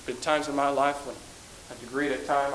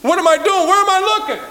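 high, loud panicked calling out; a believer's frantic cry; anguished cry; 10 dB louder than usual. Acted. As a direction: cry out like someone lost and searching. Acted lost believer crying out.

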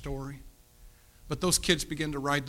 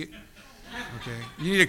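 laughing; 3 semitones higher, slower than usual. Real, not acted.

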